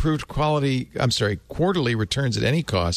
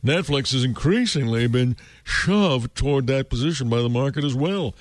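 grand, self-important newsreader voice; pompous voice; 3 semitones higher than usual. Shown as a demonstration. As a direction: read it like a pompous anchor, laughing through. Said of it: "if I do it in a, um, pompous voice".

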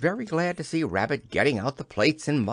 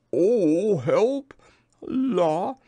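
high, effortful yelp inside a hum; straining; 8 semitones higher, 6 dB louder, much slower than usual. A narrator's reading. Pooh trying to reach his toes, high strained cry. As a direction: squeal it as if stretching too far. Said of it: "as he tried to reach his toes".

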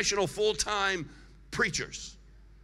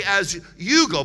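"you" punched louder, phrase slowed; stress on "you"; 6 dB louder than usual. Shown as a demonstration. Preacher prompts everybody to say it, stressing "you". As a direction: hit "you" hard in "As you go". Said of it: "Put the emphasis on you".

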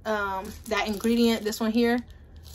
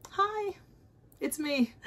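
small, soft, pretend-sheepish voice; mock-timid greeting; 4 semitones higher, 6 dB quieter, slower than usual. Customer emailing the brand about lip scrub, mock-timid. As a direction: softly play a sheepish asker. Acting out her email to seller.

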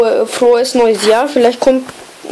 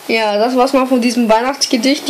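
ordinary talk

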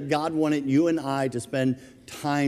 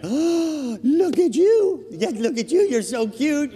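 put-on much higher, slow cooing baby talk; gushing dog lady; 13 semitones higher, 4 dB louder, slower than usual. Woman on the driveway cooing at the dog, high baby talk. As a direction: coo over the dog like a smitten stranger. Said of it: "She goes bananas over the dog".

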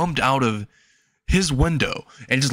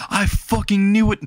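triumphant outburst; 8 semitones higher than usual. Acted. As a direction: shout it, vindicated. Boy sees the transformation.

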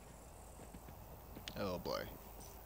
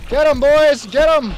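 high, loud cheer on the troops; battle cry; 10 semitones higher, 16 dB louder, slower than usual. Real, not acted. A player urging his soldiers into battle, yelling. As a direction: roar them into the fight.

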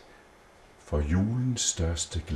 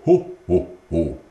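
proper Santa laugh; 4 dB louder than usual. A narrator's reading. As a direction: show how Santa really laughs. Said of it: "Julemanden, han siger".

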